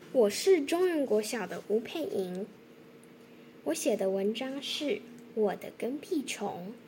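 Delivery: ordinary delivery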